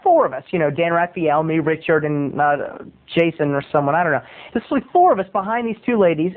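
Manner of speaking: ordinary talk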